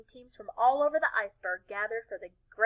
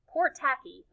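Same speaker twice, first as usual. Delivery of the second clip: ordinary delivery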